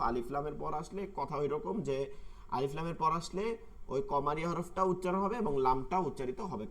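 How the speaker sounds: ordinary talk, nothing marked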